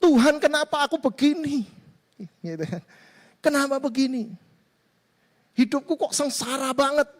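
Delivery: a hint of tearful self-pity; mock crying complaint. Troubled believer complains to God, mildly weepy. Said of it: "Si orang ini nangis".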